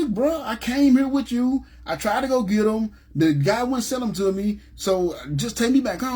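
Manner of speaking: high, slowed insistence; heated pleading. Retold or imitated. Defending himself to his friend in the car, high and insistent. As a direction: plead your case hard and slow.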